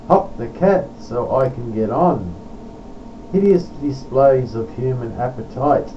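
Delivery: rhymed verse declaimed line by line; poetry recitation. Acted. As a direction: recite with measured pauses after each rhyme. Recites his own poem.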